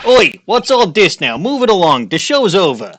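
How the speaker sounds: put-on loud old-time cop shouting; 1930s beat cop. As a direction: bark orders like a street cop. Voicing the policeman.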